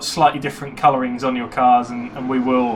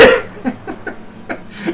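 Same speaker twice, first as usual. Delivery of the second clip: a snarf, sneeze and laugh at once; 14 semitones higher than usual. Real, not acted. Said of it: "that was a sneeze laugh, a snarf".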